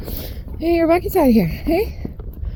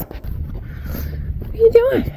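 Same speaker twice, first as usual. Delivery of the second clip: cooing pet talk; 12 semitones higher, much slower than usual. Real, not acted. Owner coos slowly at her baby goats. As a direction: coo it slowly at the little goats.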